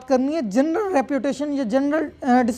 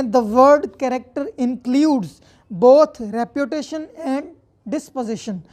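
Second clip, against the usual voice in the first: slow dictation; much slower than usual. Shown as a demonstration. Reads statute text aloud.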